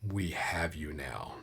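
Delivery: masked soldier whispering to the captured party. Acted. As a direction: hiss it out of the dark. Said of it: "you hear this voice".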